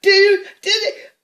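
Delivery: high, loud sobbing protest; hysterical teen. Retold or imitated. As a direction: cry it out in disbelief. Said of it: "I'm like".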